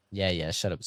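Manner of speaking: ordinary talk, nothing marked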